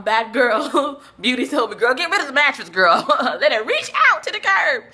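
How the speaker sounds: put-on loud, high, sassy girlfriend voice; playful high-energy scolding. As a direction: call her out like a teasing friend. Acted girlfriend advice about the mattress.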